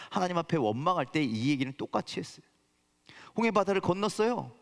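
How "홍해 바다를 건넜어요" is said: normal talking voice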